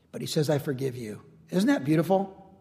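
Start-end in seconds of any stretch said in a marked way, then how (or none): 0.5-1.2 low, very soft and sincere; tender forgiveness. Acted. Joseph to the brothers who wronged him, tender and hushed. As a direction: drop right down to gently forgive.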